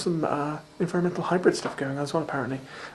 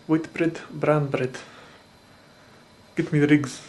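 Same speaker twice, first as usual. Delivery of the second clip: a hint of a South African accent, Lethal Weapon style; mock South African villain, lightly done; 3 dB louder, slower than usual. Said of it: "saying Caprica in a South African accent".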